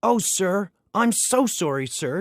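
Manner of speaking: high, flustered stammered sorry; a touch of panicked sputtering; sputtering apology. A narrator's reading. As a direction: blurt it out, caught and flustered. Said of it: "Thomas sputtered".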